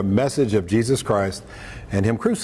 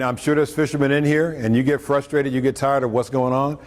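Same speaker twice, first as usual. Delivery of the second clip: ordinary delivery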